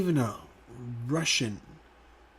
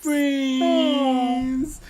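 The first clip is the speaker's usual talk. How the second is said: mock crying; 11 semitones higher, 3 dB louder than usual. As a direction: fake sob on friends. Mock sad over best friends.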